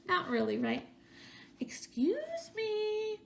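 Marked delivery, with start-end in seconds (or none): 1.6-3.2 little voice. A narrator's reading. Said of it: "a little voice called out".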